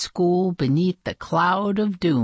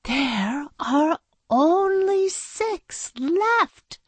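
high, very slow, dismayed; high, drawn-out lament; 12 semitones higher, much slower than usual. Acted. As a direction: stretch it out in despair. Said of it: "shaking her head in dismay".

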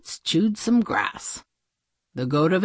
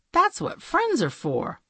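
ordinary delivery, nothing marked

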